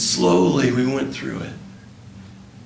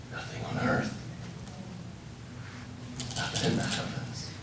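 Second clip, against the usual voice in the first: softer, unvoiced guided questions; intimate whisper; 12 dB quieter than usual. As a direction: draw her into the embrace softly. Whispered guided imagery continues.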